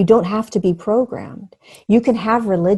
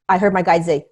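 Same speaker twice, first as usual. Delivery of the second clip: normal talking voice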